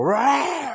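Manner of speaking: very high, loud drawn-out roar; explosive imitated lion roar. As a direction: roar full force like an angry lion. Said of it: "roars at him again".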